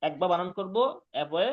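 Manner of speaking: normal talking voice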